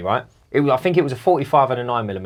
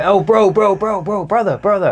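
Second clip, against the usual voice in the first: higher, imitated street hustler calling out; urgent hailing; 6 semitones higher, 5 dB louder than usual. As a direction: pester him with rapid repeated calls. Imitates stranger hailing him urgently.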